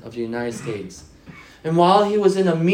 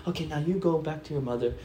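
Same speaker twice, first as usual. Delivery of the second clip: softer, gentle fatherly murmur; tender father; 7 dB quieter than usual. Retold or imitated. President hugging his daughter, soft and tender. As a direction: soothe her kindly. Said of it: "Gives her a hug and go".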